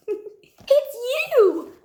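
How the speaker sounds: high laughing exclamation; giggle, then burst out high and loud. A narrator's reading. Duckbills amused at Drip's question, laughing high cry. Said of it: "And they laughed and said".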